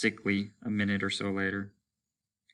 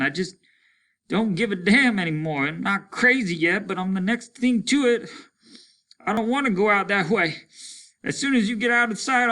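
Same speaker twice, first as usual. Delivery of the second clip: loud despair; 12 semitones higher, 5 dB louder than usual. Acted. Deafened, desperate, loud.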